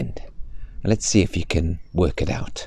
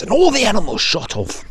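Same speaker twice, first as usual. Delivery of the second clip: excited race start; 13 semitones higher, 4 dB louder than usual. Acted.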